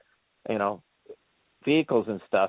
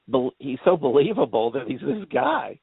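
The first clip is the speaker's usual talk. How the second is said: ordinary delivery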